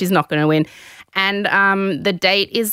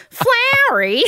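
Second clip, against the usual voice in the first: high-pitched mock indignant squawk; hammed-up affronted woman, voice shooting up; outraged impersonation; slower than usual. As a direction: repeat it back in squeaky disbelief. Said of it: "take from my impersonation what you will".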